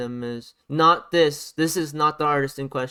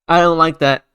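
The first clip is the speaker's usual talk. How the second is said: much louder, a sudden burst of annoyance; annoyed outburst; 11 dB louder than usual. Real, not acted. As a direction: blurt it out, fed up. Rant about overproduced song.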